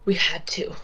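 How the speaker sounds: strangled coughs, then a strained reply; choked. Acted. Man gripped by the throat answering his attacker, choked. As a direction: force the words through a squeezed throat. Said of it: "he's having a hard time breathing through".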